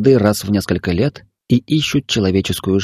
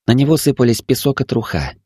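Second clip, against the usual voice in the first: usual speaking voice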